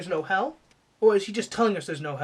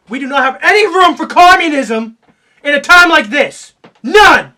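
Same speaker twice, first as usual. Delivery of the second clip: loud, higher patriotic tirade; hammed-up indignant yelling; outraged rant; 4 semitones higher, 15 dB louder than usual. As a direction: lay down the law at a shout. Patriotic rant against the lyric.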